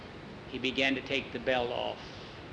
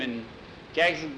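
ordinary delivery, nothing marked

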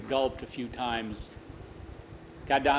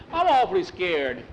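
higher, louder fearful plea; very scared boy; 5 semitones higher, 5 dB louder than usual. A narrator's reading. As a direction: blurt it out in fright. Bowie repeats fear more strongly.

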